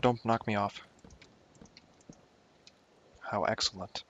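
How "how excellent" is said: ordinary talk, nothing marked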